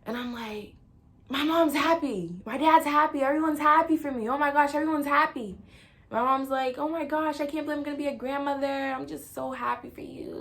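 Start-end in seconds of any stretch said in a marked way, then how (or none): 1.3-5.6 loud, high sing-out of joy; elated relief. Retold or imitated. Daughter realizing everyone is happy for her, loud elation. 6.8-10.4 high, excited imitation of mom; gushing mom. Mom about becoming a grandmother, excited gushing.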